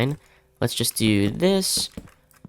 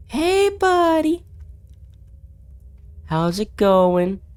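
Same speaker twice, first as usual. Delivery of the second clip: very high, slow nervous call; sing-song coaxing; 14 semitones higher, much slower than usual. Real, not acted. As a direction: sweet-talk a scary creature. Calling to the monster.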